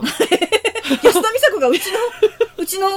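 high, loud words through laughter; incredulous laughter. Real, not acted. Co-host scoffing at a celebrity on their own show, laughing incredulously. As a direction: crack up in disbelief.

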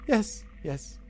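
high, pleased "Yes, yes"; a contented little goblin. Acted. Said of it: "He's like".

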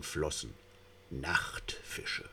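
hushed, breathy eerie whisper; eerie narrator whisper. A narrator's reading. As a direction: whisper it like a secret in the dark.

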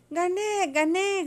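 high, excited victory cry; triumphant shout. A narrator's reading. Pili claims the jumping contest, shouting. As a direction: crow it up high. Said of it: "gritó Pili".

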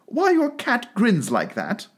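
high, slow, careful question; a little timid. A narrator's reading. Alice speaks first to the Duchess, a little timidly. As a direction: venture the question gently. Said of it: "a little timidly".

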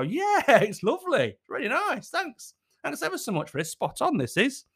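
high, gushing mock appreciation; dutiful diner's put-on enthusiasm; over-polite praise. Acting the obligatory polite praise.

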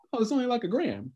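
high, offhand mock brush-off; imitated dismissive officer. Police to housing staff about marijuana, mock dismissiveness. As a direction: mimic someone waving it away.

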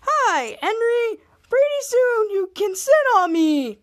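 high, singsong toilet-seat character; be a toilet seat talking, high-pitched and drawn out; silly high voice for a talking object. Acted. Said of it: "he gave the toilet seat a new voice".

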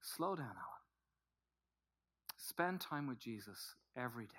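low, very soft fatherly advice; remembered father's voice. Retold or imitated. Preacher recalls his dad's counsel, soft remembered father's voice. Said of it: "I can hear it so well".

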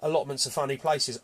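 normal talking voice

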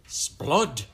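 loud, high regal exclamation; outraged royal cry. A narrator's reading. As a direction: cry out like an affronted queen. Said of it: "cried good Queen Bess".

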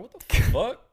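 loud, high disbelieving blurt; shocked outburst. Retold or imitated. Friends spot the strange pendant, shocked outburst. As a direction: react aloud in retold shock.

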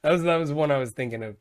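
normal talking voice

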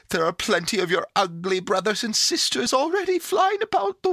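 put-on high, loud, indignant little girl; scolding. A narrator's reading. Pandora refuses the naughty creature in the box, scolding.

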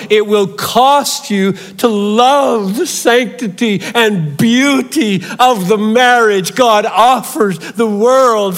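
high, loud, impassioned sermon cry; fiery pulpit warning at full volume; full-force preaching. Acted.

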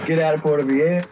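lightly voiced devil command; mean devil voice. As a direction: imitate the devil ordering you out. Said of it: "like mean like that".

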